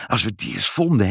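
plain reading voice

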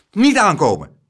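loud, high, sharp command; forceful warning shout; fierce call. A narrator's reading. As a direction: order them back firmly. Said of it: "riep hij met felle ogen".

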